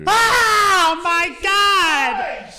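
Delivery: high, slow, hammed-up shriek; scared scream. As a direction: overact a terrified cry.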